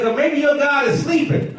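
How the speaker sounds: high, teasing taunt; mocking. Elijah teasing worshipers about their silent god, mocking. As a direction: needle them in a high, amused voice. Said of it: "He started mocking them".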